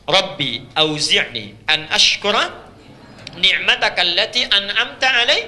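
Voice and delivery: slow, drawn-out chanted Arabic verse; Quran recitation. Acted. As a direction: recite it like in prayer, melodic and slow.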